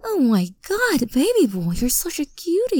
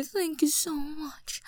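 ordinary delivery

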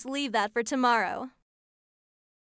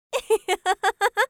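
bright teasing laugh; 7 semitones higher, 4 dB louder than usual. Real, not acted. Laughing at own joke.

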